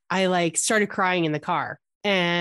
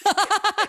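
high, bright burst of laughter; 14 semitones higher than usual. Real, not acted. Friend laughing at her binder story, high laugh.

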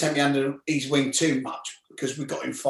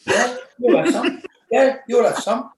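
mock tough guy; 9 semitones higher, 5 dB louder than usual. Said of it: "they're both like".

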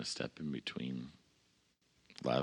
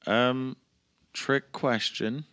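slow, high, wry "trick question"; sarcastic; 9 semitones higher, 7 dB louder, much slower than usual. Real, not acted. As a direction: drag it out, amused and dry. Player reacts to GM's question.

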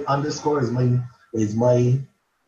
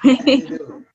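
excited agreement; 12 semitones higher, 3 dB louder than usual. Real, not acted. Agreeing to the joke title.

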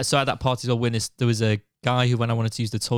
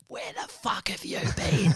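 harsh whisper, pitched up; angry whispered mum; 8 semitones higher than usual. Retold or imitated. Mum hissing at her son coming home at six, a furious whisper. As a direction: hiss it like a furious mum at dawn. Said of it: "my mum was like".

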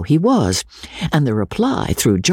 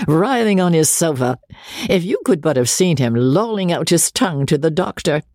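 lively banter; 8 semitones higher than usual. A narrator's reading. George bantering to Miss Sharp about Joseph, high gleeful mockery. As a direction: relish mocking the sick brother. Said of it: "he bantered with a great deal of liveliness".

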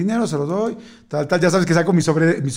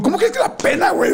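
incredulous retort; 13 semitones higher than usual. Retold or imitated. Retorting to a friend's advice.

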